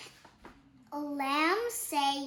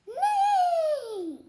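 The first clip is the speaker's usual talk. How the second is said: high falling whinny; horse neigh; 12 semitones higher, 4 dB louder than usual. Pretend. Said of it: "I can do the same thing as horses".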